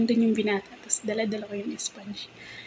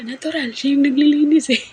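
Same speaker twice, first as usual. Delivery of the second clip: higher and much louder retort; mother's retort; 6 semitones higher, 11 dB louder than usual. Retold or imitated. Mother fires back at her son that Jeline cleans, loud retort. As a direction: snap back at the son, a touch amused.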